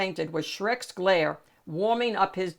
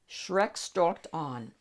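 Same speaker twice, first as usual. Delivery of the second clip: usual speaking voice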